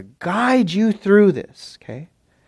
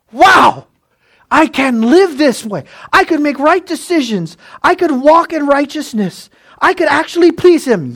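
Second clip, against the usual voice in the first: excited realisation; 11 semitones higher, 5 dB louder than usual. Retold or imitated. Believer's joy acted out.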